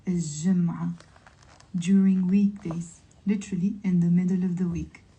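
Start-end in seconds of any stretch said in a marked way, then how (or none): none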